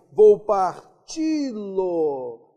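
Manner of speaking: parti-lo stretched very slowly and loudly, leaning on ti; slow, loud demonstration of ti; drawn-out stress. Shown as a demonstration. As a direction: hold the ti of parti-lo. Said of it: "oxítona terminada em I".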